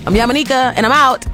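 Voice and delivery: ordinary talk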